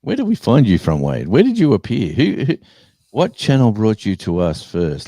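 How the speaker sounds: loud, high, eager calls to a viewer; excited calling out. Real, not acted. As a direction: hail him across the chat with excitement.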